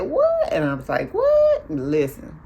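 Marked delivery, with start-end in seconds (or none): 0.0-0.5 very high, loud rising squeal; hammed-up high disbelief; mock shock. Second friend's shocked reaction, retold. 1.1-1.7 loud, very high squeal; hammed-up piercing disbelief; choir-like mock shock. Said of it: "I was like the choir back there".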